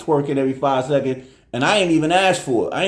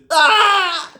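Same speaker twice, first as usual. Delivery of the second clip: high, louder imitated wail; mock sob; 6 dB louder than usual. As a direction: mimic the girl bursting into tears. Imitating her emotions breaking.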